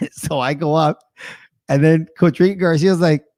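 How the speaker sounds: ordinary talk, nothing marked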